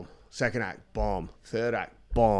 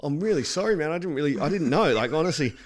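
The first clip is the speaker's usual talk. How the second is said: imitated high, pleading apology; near-tears apology; 6 semitones higher, 5 dB louder than usual. Comic retelling his apology to the upset act, high pleading. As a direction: plead earnestly, pitched up, no real crying. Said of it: "nearly in tears".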